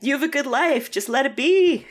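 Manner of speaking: high-pitched, exasperated plea to a character; mock pleading from the sidelines. Voicing viewer pleading with character.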